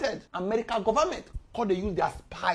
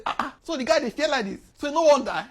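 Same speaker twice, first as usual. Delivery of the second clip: quick, higher and louder, teasing the critics; a scoffing onlooker, quick and loud; mocking gossip voice; 6 semitones higher, 5 dB louder than usual. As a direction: play the scoffing crowd, fast and raised. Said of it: "Some people dey talk say".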